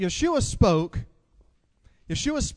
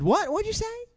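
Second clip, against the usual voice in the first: put-on high shrill-free squeak of a half-deaf old wife, much higher. Old wife straining to hear her husband, high squeaky voice. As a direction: play the wife who can't hear, pitched way up.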